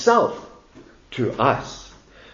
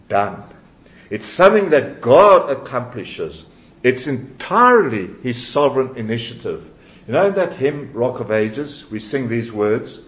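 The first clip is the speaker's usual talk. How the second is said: usual speaking voice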